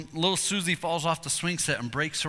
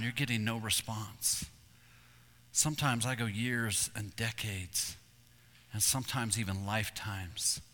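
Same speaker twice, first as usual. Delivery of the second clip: tender voice of God; 4 semitones lower, 5 dB quieter than usual. Retold or imitated. God answering Arby by the campfire, soft and tender. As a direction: gently speak as God calling his people. God speaking softly to the hunter.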